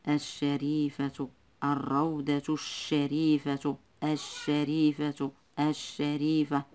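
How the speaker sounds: الشريفة drawn out slowly, lam silent and sh doubled. Shown as a demonstration. Teacher showing pupils a silent lam, الشريفة slow with doubled sh. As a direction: stretch the doubled sh in الشريفة, no l sound. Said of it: "اللام لا تنطق".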